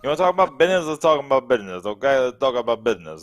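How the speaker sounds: a lightly put-on North Jersey Italian mobster voice; a playful wiseguy pitch, said to the host in a mock Italian accent. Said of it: "that was my little mobster".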